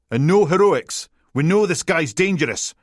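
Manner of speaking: high, forceful radio order; loud commanding warning. A narrator's reading. Commander warning the team over the airwave, loud urgent order.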